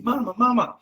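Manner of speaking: put-on high little-girl call of mama; child calling mama. Small daughter calling her mom in a crowd, high childlike voice.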